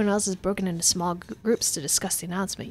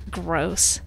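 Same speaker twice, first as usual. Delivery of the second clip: normal talking voice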